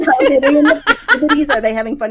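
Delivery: high, loud laughing question. Real, not acted. Laughing while telling friends about the call. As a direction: laugh through each word. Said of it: "Are you drinking?".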